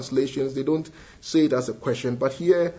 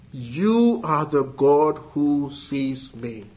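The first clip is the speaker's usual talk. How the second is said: slow and lower, weighing each word of the God who sees me; slow, pondering; 4 semitones lower, much slower than usual. Acted. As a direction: let it sink in slowly. Repeats Hagar's confession twice.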